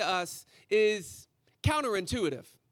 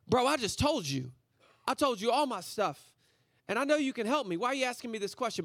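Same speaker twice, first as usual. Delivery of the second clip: ordinary talk, nothing marked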